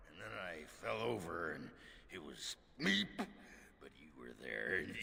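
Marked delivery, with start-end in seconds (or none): none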